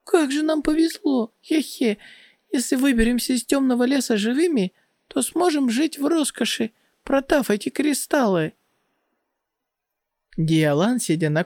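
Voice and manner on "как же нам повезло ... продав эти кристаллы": high, fast, gleeful chatter; shrieking jackpot winner. Acted. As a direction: gush like you just struck it rich. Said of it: "Моюде радостно закричала".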